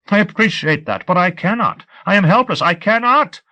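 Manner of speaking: high, desperate pleading that climbs; helpless babbling. A narrator's reading. Captive unable to prove his story, babbling desperately. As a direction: plead helplessly, pitch rising to the last words. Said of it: "I was babbling".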